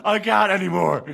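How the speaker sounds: ordinary delivery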